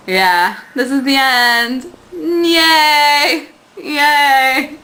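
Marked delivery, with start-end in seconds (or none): 2.1-4.8 high, drawn-out yay; playful cheer. Pretend. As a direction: celebrate the ending in a cutesy squeal.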